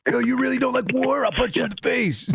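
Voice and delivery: high, sarcastic jeer before a punch; mocking bully taunt. A bully sneering at a Quaker before a punch, high and mocking. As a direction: taunt him high and snide.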